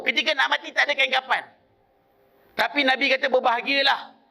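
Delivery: sustained high-pitched sermon storytelling; preacher solemnly relaying a prophecy. Acted. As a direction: carry the tale on a high, insistent pitch. Retells prophecy in raised preaching pitch.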